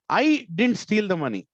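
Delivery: "I" said loud, high and drawn out; stress on I. Shown as a demonstration. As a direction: punch the word "I" hard, then let the rest fall. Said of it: "I'm saying "I" very loudly".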